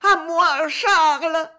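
high, loud cry for help; cri d'épouvante. A narrator's reading. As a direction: call desperately for rescue, terrified. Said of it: "cria Violetta d'une voix d'épouvante". (appel au secours affolé, aigu et fort)

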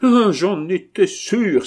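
loud, high, drawn-out exclamation; crying out. Acted. Abbé hearing who did it, loud vindicated cry. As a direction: cry out in indignant certainty. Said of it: "s'écria l'abbé".

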